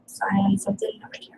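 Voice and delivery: whispered. Real, not acted. Aside to self.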